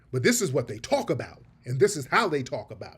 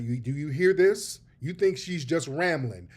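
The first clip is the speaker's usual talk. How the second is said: normal talking voice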